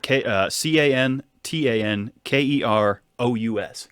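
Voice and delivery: slow letter-by-letter spelling. Shown as a demonstration. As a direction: spell it out slowly. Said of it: "you want me to spell it".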